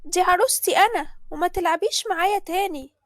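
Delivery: put-on high, loud shout of a spoiled girl; a jealous big sister yelling, high and loud; bratty child yell. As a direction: snap at her little sister to go away. Older sister Sally shouting at child.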